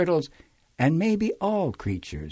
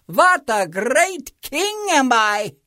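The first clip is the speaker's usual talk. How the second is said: high, slow self-admiring boast; smug King Yertle; 8 semitones higher, 4 dB louder, slower than usual. A narrator's reading. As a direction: savour your own greatness. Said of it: "saying over and over".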